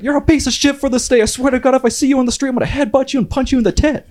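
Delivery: put-on loud, high Chicago-accent rant; drunken yelling. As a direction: scream threats at the empty mansion. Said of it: "yelling, like, nonsensical issues".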